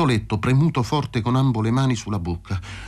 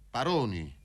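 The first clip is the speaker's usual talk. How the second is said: soft, hollow call from darkness; cavernous voice; 9 dB quieter than usual. A narrator's reading. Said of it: "chiamò una voce cavernosa".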